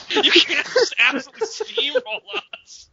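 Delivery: high laughing complaint; laughing protest. Real, not acted. Laughing complaint to absent friend.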